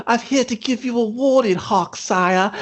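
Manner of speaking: high British urchin voice; little British kid. Acted. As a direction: deliver it as a put-on little British messenger boy. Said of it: "the little British kid's like".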